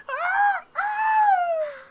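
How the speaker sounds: very high, loud scream flying away; falling scream. Retold or imitated. Game character launched off a bridge, screaming high. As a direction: yell like a man launched into the sky. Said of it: "and he goes".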